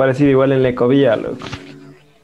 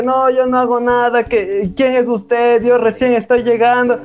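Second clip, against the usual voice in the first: higher, innocent denial in a put-on voice; imitating the old man; 7 semitones higher, slower than usual. Mimicking the old man denying the theft, higher, innocent. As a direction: play the old thief acting clueless. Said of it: "Y el señor como que".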